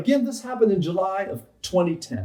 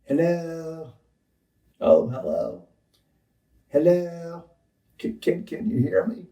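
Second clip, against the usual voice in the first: stammering Bill Clinton; slower than usual. Retold or imitated. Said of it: "I, I stammered like that".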